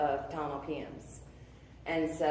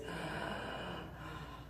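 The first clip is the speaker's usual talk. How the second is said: long soft sigh; 11 dB quieter than usual. Real, not acted. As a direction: let the breath out slowly as peace arrives. Reliving the moment peace came.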